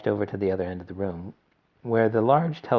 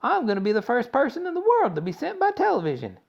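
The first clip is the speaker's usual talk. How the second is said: much higher, louder bragging; boastful boy; 6 dB louder than usual. A narrator's reading. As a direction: brag wildly. Mike boasting as he runs.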